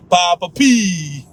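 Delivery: drawn-out teasing echo with laughter; mocking repetition. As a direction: stretch it out like students making fun. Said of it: "they don't repeat it, and they'll be laughing at you".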